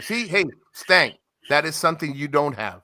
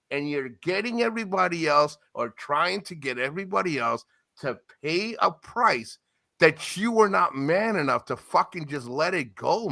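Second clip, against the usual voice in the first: usual speaking voice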